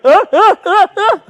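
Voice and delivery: high, loud mock laughter; imitating dad's laugh. Dad on the phone at work, imitating his loud high laughter. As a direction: ham up a howling laugh. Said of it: "dying laughing".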